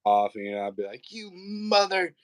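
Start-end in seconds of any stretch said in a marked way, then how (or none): 1.1-2.1 high, slow mock cursing, cut short; stifled kid swear. Dad at his son's age, high stifled cursing. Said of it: "you know, I meant motherfucker".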